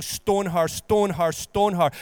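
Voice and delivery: a hint of an angry crowd chanting; mock crowd demand. The law demanding the woman be stoned, mild chant. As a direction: repeat it like a hard demand.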